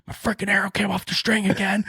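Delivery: high, loud exasperated outburst; retold freak-out. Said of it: "Like, I'm freaking out, right?".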